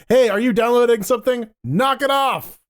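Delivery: acted yell to a housemate down the hall. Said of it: "have to yell down the hall".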